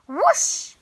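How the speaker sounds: loud swooping whoosh; whoosh sound effect. A narrator's reading. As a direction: let it rush like wind. Broom takes off.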